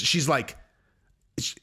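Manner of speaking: usual speaking voice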